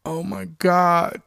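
loud, drawn-out cry of shock; panicked, full-voiced outcry; waking scream. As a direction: scream yourself awake, stretching the words. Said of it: "I wake up screaming".

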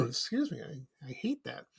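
husky, throat still catching; frog in throat. Real, not acted. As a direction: speak past a tickle in the throat. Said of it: "Got a frog in my throat".

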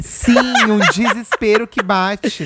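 slow words through a laugh. Real, not acted. Laughing at childhood panic story.

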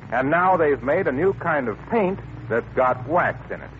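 ordinary delivery

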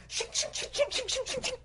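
rapid, high string of blade sounds; knife noises. Acted. Cannibals' knives behind the runner, rapid imitated clashing. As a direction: rattle off metallic scrapes. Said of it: "sound of their knives".

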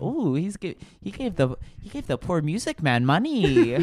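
high, swooping, gushing put-on voice; Connor O'Malley. An imagined viewer moved by charity to a musician, Connor O'Malley voice. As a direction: coo admiringly with wild pitch swoops. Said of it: "I did a Connor O'Malley voice".

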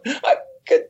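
high, tearful sobbing imitation; imitating crying athlete. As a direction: mimic someone choking up in tears. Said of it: "She's like".

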